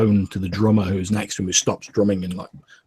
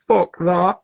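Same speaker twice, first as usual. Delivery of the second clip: higher, louder scoffing retort; defiant sailor; 9 semitones higher, 4 dB louder than usual. Acted. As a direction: sneer it from the corner. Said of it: "You hear Rotai Jack in the corner go".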